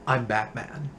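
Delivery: a flat, deadpan Batman pose; Clooney Batman. Retold or imitated.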